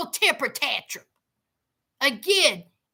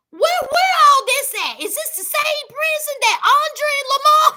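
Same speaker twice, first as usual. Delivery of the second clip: higher and louder, eager gossip; excited gossip; 6 semitones higher, 5 dB louder than usual. Real, not acted. Vlogger to her viewers, excitedly recalling the baby in the closet. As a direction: lean in, thrilled to spill the tea.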